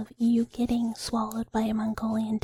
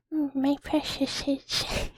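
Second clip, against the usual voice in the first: bad Gollum impression; 3 semitones higher, slower than usual. Streamer fakes Gollum for chat, slow and creepy. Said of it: "that doesn't sound like Gollum".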